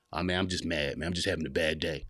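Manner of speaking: low, quiet dismissive mumble; understated, closed-off grumble; downplaying brush-off. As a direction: play a man shrugging off his bad mood, low and soft. Men brushing off their feelings.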